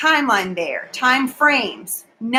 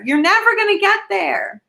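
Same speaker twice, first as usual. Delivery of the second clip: higher repeat; 9 semitones higher than usual. Real, not acted.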